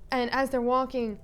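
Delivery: ordinary talk, nothing marked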